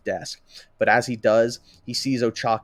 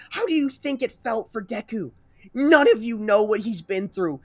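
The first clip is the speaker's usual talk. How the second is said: high, loud, sustained tirade; berating the whole class in anger; furious yelling; 13 semitones higher, 6 dB louder than usual. Acted. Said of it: "Ochako yells out at everyone".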